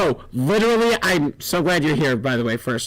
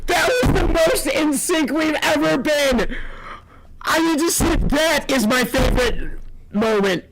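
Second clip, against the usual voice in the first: laughing with joy; 13 semitones higher than usual. Real, not acted. Overjoyed laughing at shared joke.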